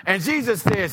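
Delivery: ordinary talk, nothing marked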